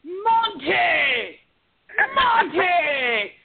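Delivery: high, drawn-out retold bellow; top-of-the-lungs yell. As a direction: imitate Gordon Ramsay calling out furiously. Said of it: "at the top of his lungs".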